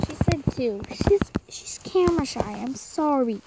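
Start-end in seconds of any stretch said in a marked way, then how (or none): 0.1-2.4 quick teasing whisper. Real, not acted. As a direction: breathe it as a secret aside. Teasing aside about shy friend.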